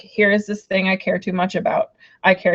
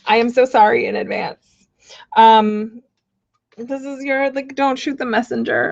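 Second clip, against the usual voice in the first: ordinary talk, nothing marked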